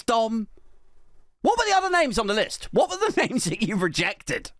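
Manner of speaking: high, incredulous questioning; mock exasperation. Comic grills Dom about other names, mock exasperation.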